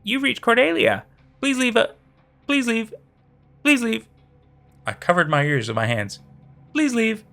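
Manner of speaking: high, chirpy recorded greeting; upbeat voicemail voice. Acted. A creepy voicemail copy of her voice, bright and upbeat. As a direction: sound like a cheery phone message. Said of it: "my voice ... too fucking upbeat".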